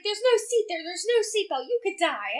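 high-pitched mock alarm; alarmed objection. Retold friend alarmed at floor seating.

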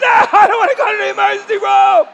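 high, loud comic wail of refusal; shouted protest. Retold or imitated. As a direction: yell it in exaggerated panic. Acted protest to the doctor.

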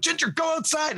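high-pitched yell, no real volume; mock yelling an order at the dog; retold yell. Host re-enacting sending puking dog out, mock yell. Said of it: "'cause I yelled at her".

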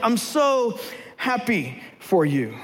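slow, deflated fake cheer; grudging congratulations to a lucky friend; forced happiness. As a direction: drag out the words through a forced smile.

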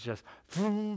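a quick cartoon vroom of a flying spear; cartoon whoosh. Preacher mimicking Saul's spear flying past, cartoon whoosh. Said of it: "I picture kinda like on cartoons".

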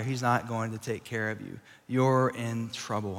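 low, soft, sinking voice; quiet, ominous inner voice; hushed dread. Acted. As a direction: drop right down and let the doubt sink in. The dread David dwells on, voiced.

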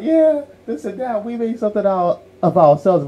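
hammed-up boasting about what they built; bragging mom. Mom now boasting about their success, mock proud and high. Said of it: "she'll talk about".

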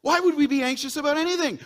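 delighted preacher's wonder; excited. Acted. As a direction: gush with wonder at the birds.

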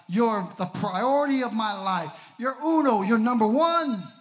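loud, joyful cry to God; rising exclamation of devotion; exultant. Acted. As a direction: lift it up, loud and proud.

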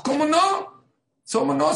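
high, loud incredulous protest; scoff, how could it not be; indignant disbelief. Retold or imitated. Lady protesting to her seatmate, high and loud. Woman insists in retold dialogue.

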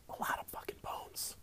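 whispered aside, no voice; whispering. Real, not acted. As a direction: breathe it as a secret aside. Whispered aside while reading.

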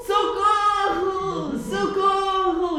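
high, drawn-out sung cry; cry for help. A narrator's reading. Father stuck in the tree calling for help, drawn-out. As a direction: call for help, stretching each word. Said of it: "E o pai gritou".

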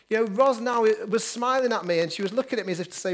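ordinary talk

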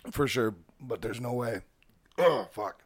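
choking on swallowed water; choked up, coughing. Real, not acted. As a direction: talk through coughs, as if crying. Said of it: "I sound like I'm crying".

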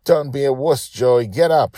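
put-on high, loud bossy child order; commanding little girl. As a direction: bark it like a tiny captain. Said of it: "she commanded".

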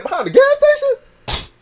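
high, loud shocked question; high disbelief. Retold or imitated. Friend's disbelief retold.

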